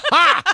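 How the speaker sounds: loud laugh burst. Real, not acted. Laughing at memory.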